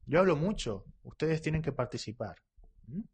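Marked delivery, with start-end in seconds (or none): none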